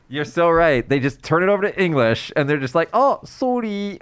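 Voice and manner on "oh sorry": high, drawn-out cutesy sorry; mock pitiful apology. Imitating Japanese speakers' casual English apology, mock pitiful. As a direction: slowly plead in a higher, poor-me voice. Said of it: "they always make it like a kawaiso face".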